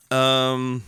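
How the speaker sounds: burp slipping into the word; burping after fizzy drink. Real, not acted. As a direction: talk through a soda burp. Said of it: "I'm just burping a lot because of the soda".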